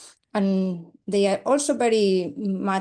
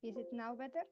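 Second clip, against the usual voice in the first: faint, distant question off the mic; barely audible; 4 semitones higher, 18 dB quieter, faster than usual. Real, not acted. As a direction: ask it too far from the microphone. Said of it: "it's still kind of quiet".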